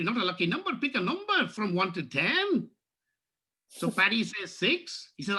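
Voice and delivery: a light Irish lilt throughout; lightly voiced Irish storyteller; Irish accent joke telling. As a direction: tell the Paddy joke in a mild Irish brogue.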